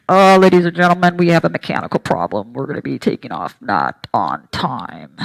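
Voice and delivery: low, droning captain over the intercom; airline captain announcement. Retold or imitated. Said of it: "the captain comes on".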